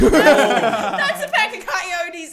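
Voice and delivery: loud, high, long-drawn alarm; hammed-up shriek through laughter; mock panicked cry. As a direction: wail it out in comic panic. Repeats coyote alarm, now loudly.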